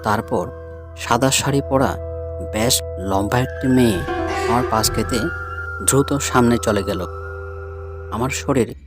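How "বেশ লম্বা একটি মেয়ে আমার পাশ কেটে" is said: plain reading voice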